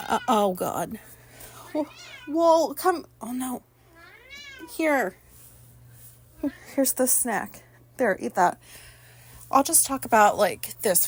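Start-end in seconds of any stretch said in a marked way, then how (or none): 2.3-8.6 talking to pet. Real, not acted. Coaxing her pet with a snack.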